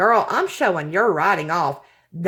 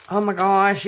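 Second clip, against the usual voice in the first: ordinary delivery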